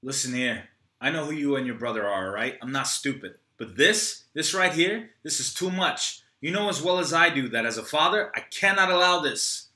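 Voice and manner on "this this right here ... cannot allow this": higher, louder father's stand; firm anger. Acted. Troy warns Rocky off his son, firm. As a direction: draw the line as a father.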